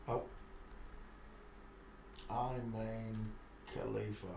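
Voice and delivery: ordinary delivery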